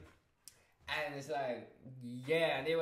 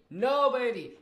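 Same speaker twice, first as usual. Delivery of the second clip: emphatic exclamation; 7 semitones higher, 7 dB louder than usual. Real, not acted. Emphatic outburst.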